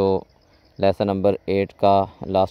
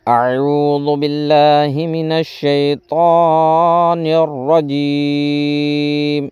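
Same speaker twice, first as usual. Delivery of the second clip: Quranic recitation; 4 semitones higher, much slower than usual. Real, not acted. Teacher starting the reading with slow, raised, melodic recitation. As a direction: intone each word, stretching the final syllables.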